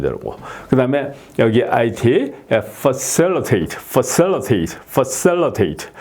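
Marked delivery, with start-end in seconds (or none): 2.6-5.9 facilitate drawn out very slowly; slow demo. Shown as a demonstration. Teacher slowly modelling facilitate for students. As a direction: stretch out each syllable of facilitate. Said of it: "이렇게 발음이 되고요".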